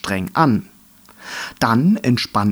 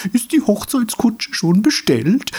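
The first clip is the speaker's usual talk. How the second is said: much higher, whooping tease; hooting; 11 semitones higher than usual. A narrator's reading. Third dandelion hoots a wedding joke, whooping. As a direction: hoot it across the meadow. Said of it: "johlte ein dritter".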